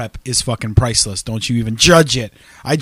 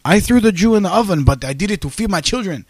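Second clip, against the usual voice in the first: mock German accent, pitched up; hammy German villain voice; 6 semitones higher than usual. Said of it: "I was trying to do a German accent".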